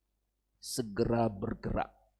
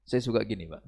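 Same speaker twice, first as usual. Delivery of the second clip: ordinary talk, nothing marked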